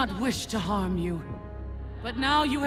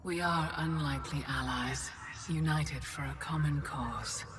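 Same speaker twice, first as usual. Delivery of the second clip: whispering; 3 semitones lower, 8 dB quieter than usual. Acted. Villain whispers to allies.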